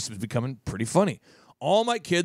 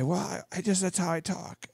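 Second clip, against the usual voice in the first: hushed mock whisper, much softer; 6 dB quieter than usual. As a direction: put on a weird, hushed little voice. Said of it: "in that weird whisper voice".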